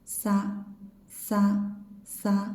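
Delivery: "Sa" with a clear hiss; sibilant sa. Shown as a demonstration. Teacher demonstrating sibilant Sa. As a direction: lean on the s of sa.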